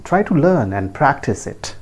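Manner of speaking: normal talking voice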